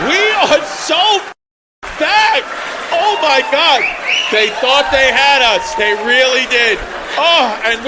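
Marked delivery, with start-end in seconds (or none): 0.1-5.8 loud, high and slow mock victory cry; mock triumphant shout. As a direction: drag out "so" and belt "back" like a rally. Mock triumphant announcement to crowd.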